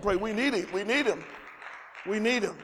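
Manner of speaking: normal talking voice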